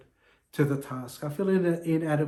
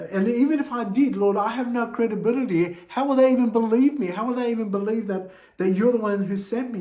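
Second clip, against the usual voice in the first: higher, louder, begging Lord for a way out; anxious pleading Moses; 6 semitones higher, 4 dB louder than usual. Acted. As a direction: Moses fretting that nobody will believe him, voice climbing. Moses voiced making excuses.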